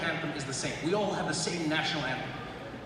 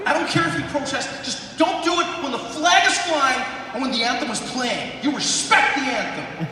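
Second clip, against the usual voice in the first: mock stern patriot; 6 semitones higher, 6 dB louder than usual. Plays angry American patriot.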